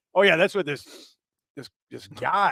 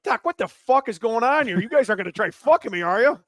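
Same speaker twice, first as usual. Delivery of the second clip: high, loud mock-indignant questioning; retold alarm; 8 semitones higher, 5 dB louder than usual. Blindfolded patient challenging the doctor, mock alarm. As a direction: act out a rattled patient demanding answers.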